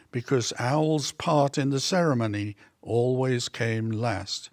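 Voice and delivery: ordinary delivery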